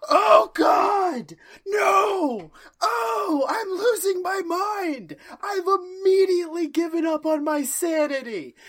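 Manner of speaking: high, wailing mock breakdown; hammed-up despairing howl; hysterical panic. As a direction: cry out as if losing your mind. Acting out instant total mental breakdown.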